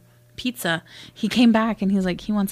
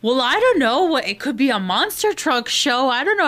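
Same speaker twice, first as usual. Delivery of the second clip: higher-pitched mock protest; defensive boyfriend; 4 semitones higher than usual. Boyfriend defending himself to his girlfriend, high-pitched protest. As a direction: imitate a clueless boyfriend protesting, pitch climbing. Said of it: "And he's like".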